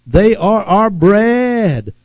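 slow, gloating taunt on bread; slow gloating boast; taunting declaration. Shown as a demonstration. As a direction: lean into it like a boast over the enemy. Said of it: "talking like that".

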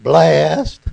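loud, drawn-out Abilene Texas twang; Abilene Texas accent. Retold or imitated. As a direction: imitate a Texas church lady's accent, loudly. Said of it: "That's the way they say it in Abilene".

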